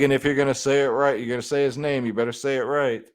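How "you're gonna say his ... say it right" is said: ordinary delivery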